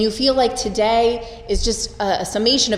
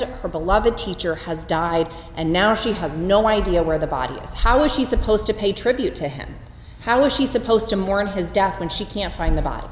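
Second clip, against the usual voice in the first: normal talking voice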